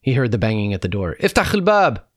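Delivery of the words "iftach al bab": high, loud soldier's yell; forceful high-pitched command; shouted order. A narrator's reading. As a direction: holler it at a closed door. Soldiers banging at the door.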